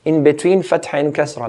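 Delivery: normal talking voice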